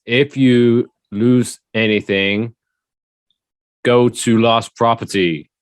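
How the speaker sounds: slow, loud monotone trainer reading slides; bored presenter. Retold or imitated. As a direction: plod through it like you hate the job. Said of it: "he pretty much delivered it like this".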